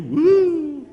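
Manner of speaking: high, loud, drawn-out mock woo. Preacher as Yeshua making fun of Nathanael's awe, mocking woo. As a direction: whoop in fake amazement. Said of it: "He's mocking him".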